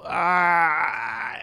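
long drawn-out mock "ah" of pain; pained cringe groan. Host reenacting a reaction to bad jokes, pained cringing groan. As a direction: groan as if a bad joke hurt. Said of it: "painful noises as a reaction".

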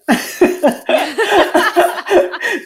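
bursting high laugh. Real, not acted. Laughing at the idea the voice was her mother, high laugh.

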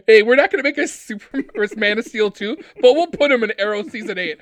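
loud, high, fired-up shout; excited outburst. Real, not acted. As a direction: get hyped and yell it out. Excited rant about casting Superman.

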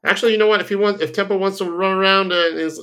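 rising excitement, giving in; excited. Real, not acted. Excited reversal about Tempo.

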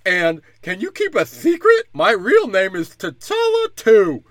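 hammed-up squeaky kid with a lisp; mocking T'Challa's son. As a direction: lampoon a lisping child confiding a secret. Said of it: "They're like".

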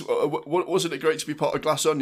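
stumble, then push on brightly; flustered interviewer. Retold or imitated. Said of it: "It says".

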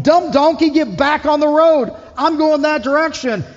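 high, loud angry shout at the donkey; angry Balaam. Acted. Balaam shouting angrily at his donkey on the road. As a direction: yell it like a man beating his stubborn donkey. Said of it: "Balaam's ... beating on this donkey, going".

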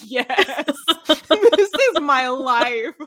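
high, drawn-out cry of recognition through laughter; laughing exclamation. Retold or imitated. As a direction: exclaim it giddily, laughing as you go.

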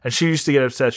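usual speaking voice

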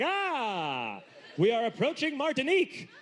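rising into a proud call to the sea; grand announcement. Retold or imitated. Joking about a cruise, a hammed-up proud announcement. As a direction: proclaim it like a ship's captain. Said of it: "leaning over the edge of a cruise ship".

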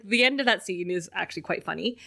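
ordinary talk, nothing marked

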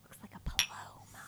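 whispering. Real, not acted. Whispered aside.

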